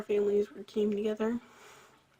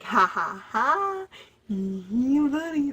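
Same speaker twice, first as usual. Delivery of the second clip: mocking laugh; 4 semitones higher, 7 dB louder, much slower than usual. Mocking the boy on bike.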